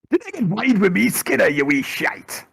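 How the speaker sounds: high, loud mock Scottish rant; mock Scottish outburst. GM jokingly ranting at Skinner in a mock Scottish voice. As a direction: bark it like an angry Glaswegian.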